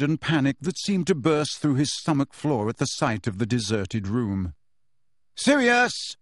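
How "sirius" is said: Harry shouting for his godfather; shouted. A narrator's reading. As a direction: call out in dread. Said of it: "he shouted".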